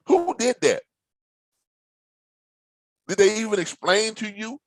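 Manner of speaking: high, disbelieving who; incredulous. Real, not acted. To new drivers about their trainers, incredulous. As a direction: ask it in total disbelief.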